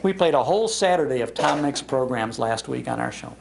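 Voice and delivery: ordinary talk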